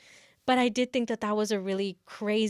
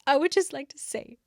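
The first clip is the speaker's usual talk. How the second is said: put-on earnest girl; 6 semitones higher, 3 dB louder than usual. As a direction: play it up as an over-earnest, fearful student. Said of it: "a girl's like".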